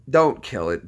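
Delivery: ordinary talk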